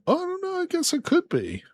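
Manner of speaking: high, pinched playful answer; a goofy high-pitched shrug; high squeaky voice. Acted. Unsure about blood moon.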